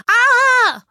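high, loud cry of fright; startled scream. A narrator's reading. Boy waking to a creature on his blanket, startled scream. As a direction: shriek out in sudden shock. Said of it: "schrie Finn".

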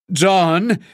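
high call with a rising end; loud call. A narrator's reading. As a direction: call his name over the noise. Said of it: "rief Suko laut genug". (laut rufend, hohe Stimme)

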